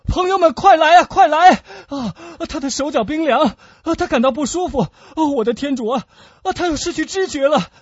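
high, panicked shouting; loud, urgent distress cries; crying for help. Acted. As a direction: call friends over in frantic alarm. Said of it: "在博尔多斯高声呼救的时候".

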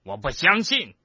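high, brusque denial; rough. A narrator's reading. Portrait refuses to believe the death, high and brusque. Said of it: "菲尼阿斯粗暴地说".

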